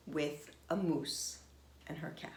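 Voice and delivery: usual speaking voice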